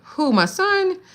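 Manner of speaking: jolted, incredulous question; surprised. Real, not acted. Reacts to comment about son.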